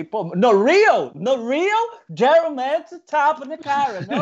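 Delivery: cada vez mais agudo e alto de espanto; espanto fingido. Retold or imitated. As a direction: finge incredulidade, subindo. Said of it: "Eu falei". (brincando que esperava apanhar, subindo bem agudo)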